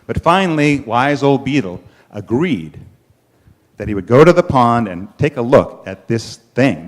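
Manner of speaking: ordinary delivery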